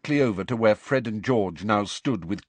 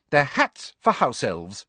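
brisk; 5 semitones higher than usual. A narrator's reading. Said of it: "she said briskly".

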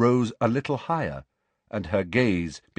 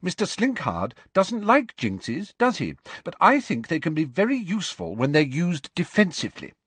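high-pitched, opinionated schoolgirl; clear, carrying voice; 8 semitones higher than usual. A narrator's reading. Pupil argues with teacher before the class, clear carrying voice. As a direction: state your view so the room hears. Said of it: "speaking in a clear, carrying voice".

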